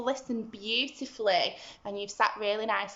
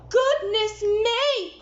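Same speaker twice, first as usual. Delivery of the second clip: high, slow, alarmed cry; 10 semitones higher, 4 dB louder, slower than usual. Acted. Giantess hears the giant coming, crying out in alarm. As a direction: cry out in sudden fright. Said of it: "cried the giantess".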